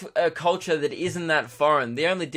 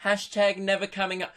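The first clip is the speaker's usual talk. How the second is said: lightly mocking, higher-pitched Instagram voice; smug travel braggart; 5 semitones higher than usual. Mocking friends posting from abroad, smug higher voice. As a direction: imitate a gloating holiday poster, pitched up. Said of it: "all over Instagram, they're like".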